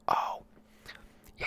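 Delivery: usual speaking voice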